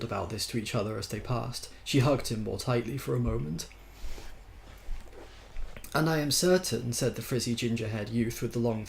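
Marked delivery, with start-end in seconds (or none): 5.9-6.9 mildly insistent, pitched up; expostulating. A narrator's reading. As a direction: press the argument from the first word. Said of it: "who was expostulating from his deck chair".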